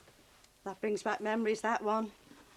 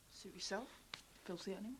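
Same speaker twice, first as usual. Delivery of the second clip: much softer, muttered aside; under-breath insult; 5 semitones lower, 14 dB quieter, faster than usual. Acted. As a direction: mutter it so he barely hears. Insulting him under her breath.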